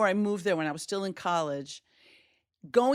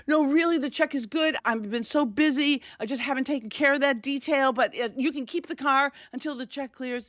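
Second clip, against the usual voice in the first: teary pleading; 7 semitones higher than usual. Retold or imitated. To the tow man about the check, teary pleading. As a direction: beg through tears. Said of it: "So I start crying again".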